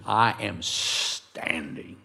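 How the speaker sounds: STANDING drawn out slowly, low; firm declaration. Acted. Believer declaring his decision, firm and slow.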